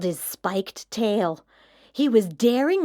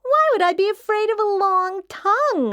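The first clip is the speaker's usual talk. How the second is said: put-on child voice; 8 semitones higher, 3 dB louder, faster than usual. Child asks, high and fast.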